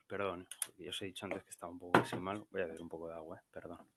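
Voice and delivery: low, very soft apologetic aside; quiet, low aside with a bad throat; sore throat. Real, not acted. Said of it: "tengo la garganta mal".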